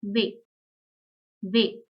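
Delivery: "Ve" as German W, veh; German letter name. Shown as a demonstration. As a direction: say "Ve" with a v sound and long e. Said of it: "is pronounced as".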